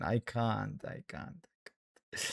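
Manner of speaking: whispered, defeated; despairing whisper. Real, not acted. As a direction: let it fade into a whisper. Despair after conceding.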